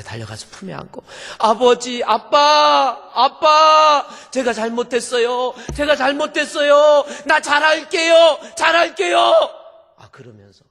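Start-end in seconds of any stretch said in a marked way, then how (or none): 1.4-9.2 shouted, much higher and louder, pleading; crying out to father. Retold or imitated. Daughter runs into father's arms, crying out a loud plea. 10.0-10.6 whispered, much softer; hushed. Real, not acted. Preacher tells the congregation she wept, hushed.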